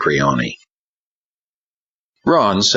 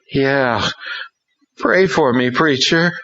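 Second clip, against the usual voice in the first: put-on much higher, pleading young voice; pleading boy; 9 semitones higher than usual. A narrator's reading. Ron pleading with Hank to pray. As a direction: beg the preacher as a weary boy. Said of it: "Ron actually pleaded".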